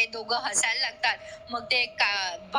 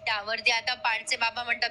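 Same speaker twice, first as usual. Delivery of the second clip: ordinary talk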